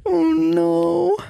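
high, slow nervous whine; half-laughing dread of a bug; scared. Real, not acted. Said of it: "I'm scared".

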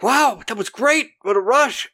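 high, gleeful whooping rush; mock thrill-seeker crowing over the kill; exhilarated. Donald after blasting the old woman, exhilarated.

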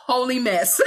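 bursting into a loud high laugh; real laugh of joyful disbelief. Real, not acted. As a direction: laugh it off, overwhelmed with joy. Laughs at her own state.

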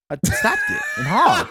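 high laughing near-shout; cracking up while confessing. Real, not acted. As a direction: laugh it out, almost crying with laughter. Laughing while retelling breaking.

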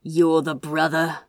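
a hint of a rough kidnapper; lightly roughened menacing voice; rough demand. A narrator's reading. Kidnapper questions brother on phone, a hint of roughness. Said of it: "demanded in a rough voice".

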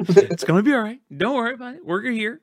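falsetto calming voice; squeaky reassuring voice; high soothing mock voice.

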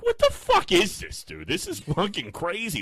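high, slow disbelieving what the fuck; incredulous outburst. Retold or imitated. Watching the PETA ad, stunned and outraged. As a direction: drag it out in total disbelief. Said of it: "I'm like".

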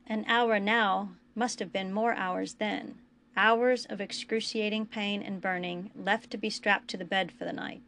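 ordinary delivery, nothing marked

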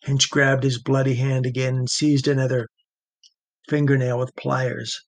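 ordinary delivery, nothing marked

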